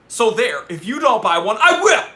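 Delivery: loud, rising to a high shout on will; playful yell. Pretend. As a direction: claim the watch, yelling. Mock threat to buy watch.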